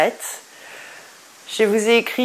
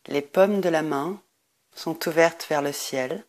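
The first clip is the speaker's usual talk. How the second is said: guided meditation; 4 semitones lower, 8 dB quieter than usual. Acted. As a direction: guide listeners into deep rest, lower and much softer. Leads a Nidra Yoga meditation.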